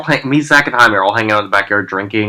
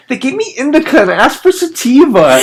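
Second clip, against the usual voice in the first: much higher, whiny imitation of a crushed friend; mock heartbroken complaint; 12 semitones higher than usual. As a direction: mimic a friend devastated over weed. Friend upset over wrong weed.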